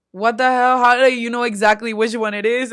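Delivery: loud stunned question; shocked. Retold or imitated. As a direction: ask it in total disbelief.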